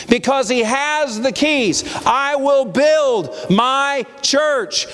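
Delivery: high, drawn-out sermon climax; impassioned preaching. Real, not acted. Preacher assuring congregation of Christ's victory, preaching with force.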